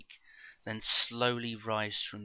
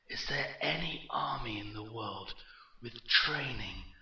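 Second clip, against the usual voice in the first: echoing villain, mockingly grand; slow and mocking. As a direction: taunt him with rhetorical questions, drawn out on key words. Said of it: "his voice slow and mocking".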